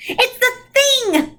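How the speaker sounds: put-on high, slow, frightened child's cry; alarmed cry. A narrator's reading. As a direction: cry out at the sight of the dark thing. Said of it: "Charles Wallace cried".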